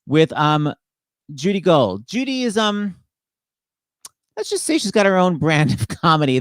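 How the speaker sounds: ordinary delivery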